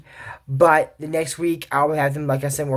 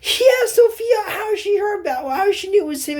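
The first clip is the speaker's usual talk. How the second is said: much higher, crying-out shout at the screen; outraged shout; 13 semitones higher, faster than usual. Real, not acted. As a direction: yell at the TV in protest.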